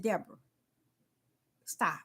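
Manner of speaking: soft, low, steady answer; God answering Deborah softly and firmly; God's calm reply. Retold or imitated. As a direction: quietly refuse to be moved. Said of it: "He said".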